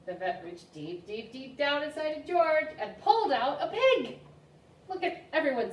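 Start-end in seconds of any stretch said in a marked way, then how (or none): 0.1-4.2 slow repeated deeps climbing high and loud; building reveal. A narrator's reading. Librarian revealing the pig to kids, excited build. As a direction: build and build to a shouted surprise.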